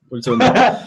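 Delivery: laughing disbelief. Retold or imitated. Drummer told about surprise medley at gig, laughing disbelief. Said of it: "I'm like".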